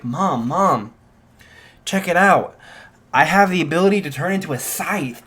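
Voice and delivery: put-on mildly excited boy showing off; excited son. Son showing his mom a new power, excited.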